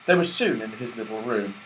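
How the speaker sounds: ordinary delivery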